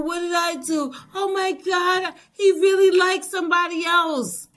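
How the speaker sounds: high, bewildered inner voice; confused victim. Retold or imitated. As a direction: play the hurt partner wondering what she did.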